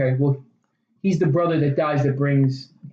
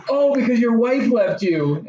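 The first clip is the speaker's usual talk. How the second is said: blunt Ryan line; 7 semitones higher, slower than usual. Retold or imitated. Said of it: "Ryan says".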